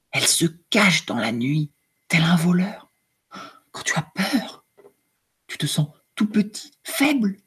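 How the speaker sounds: soft, hushed storybook reading with whispered lines; story reading to children. A narrator's reading. Teacher reading the emotion book to her class, hushed and soft. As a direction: read it gently to young pupils, slowing on the calm parts.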